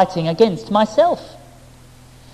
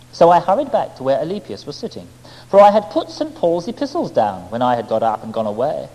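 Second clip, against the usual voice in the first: usual speaking voice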